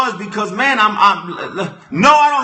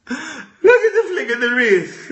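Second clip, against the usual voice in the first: laughing; 5 semitones higher than usual. Real, not acted. Repeated line, laughing cry.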